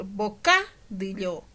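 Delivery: misplaced stress, boCA-dillo. Shown as a demonstration. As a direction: hit the CA in "bocadillo", slowly. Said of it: "No es lo mismo decir".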